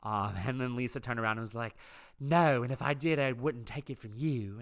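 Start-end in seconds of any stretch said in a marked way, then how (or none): 2.2-4.6 put-on lightly Southern-accented retort, starting loud; accidental Southern twang on an imitated comeback. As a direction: snap back with a touch of Southern accent. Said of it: "that came out in a Southern accent".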